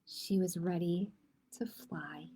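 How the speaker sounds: dropping low and soft to a whisper; hushed ending. A narrator's reading. Narrator closing the story for listening children, whispered. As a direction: let the final words fade to a breath.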